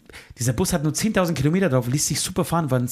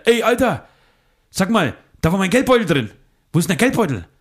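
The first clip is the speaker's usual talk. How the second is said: a raised, higher demanding voice on the phone; friend's call; 5 semitones higher, 4 dB louder than usual. Retold or imitated. Friend on the phone demanding his wallet back, raised voice. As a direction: play the friend calling in, agitated and louder.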